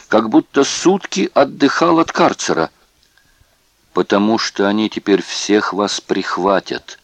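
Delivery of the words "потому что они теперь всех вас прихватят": ordinary delivery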